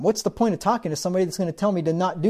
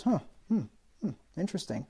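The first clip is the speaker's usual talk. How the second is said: noncommittal murmur; 10 dB quieter, faster than usual. Retold or imitated. Pastor's noncommittal reply, retold.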